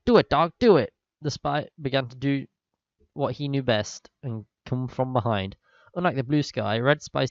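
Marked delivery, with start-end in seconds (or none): 0.0-0.9 shouted, loud and high; loud cheer. Acted. Reader voicing an excited cheer, shouting. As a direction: egg them on at full volume.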